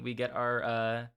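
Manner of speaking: usual speaking voice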